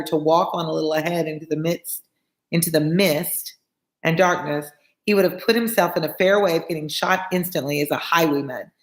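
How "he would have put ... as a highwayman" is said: ordinary delivery, nothing marked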